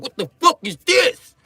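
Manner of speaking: hammed-up Italian accent, high and indignant; an outraged Italian cook scolding; angry Italian. Comic plays an Italian furious about processed cheese, mock Italian accent. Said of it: "Italians be mad about it".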